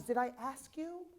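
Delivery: mock retort. Teacher to a student who over-explains, mock challenging. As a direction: question the student's needless excuse, lightly and high.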